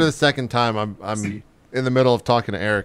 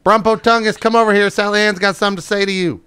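higher and louder call across the room; 10 semitones higher, 5 dB louder than usual. Acted. Guest calling his prize fighter over to scare the clerk, loud.

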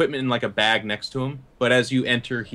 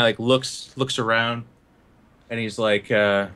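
ordinary talk, nothing marked

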